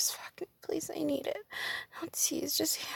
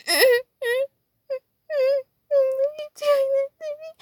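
much higher, louder wail; high crying outcry; 11 semitones higher, 9 dB louder than usual. Real, not acted. Woman wailing at her partner, high and loud.

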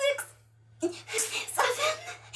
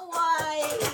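usual speaking voice

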